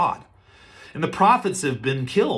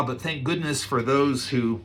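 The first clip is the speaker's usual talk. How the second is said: ordinary talk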